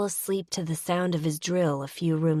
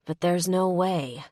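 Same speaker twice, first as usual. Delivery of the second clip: ordinary delivery, nothing marked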